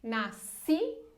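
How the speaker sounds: "Nascido" slow, weight on "ci"; "Nascido" with the stressed syllable held; stress the tonic. Shown as a demonstration. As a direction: lean on "ci" in "Nascido", drop the end. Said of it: "a gente só conta até a sílaba tônica, mais forte".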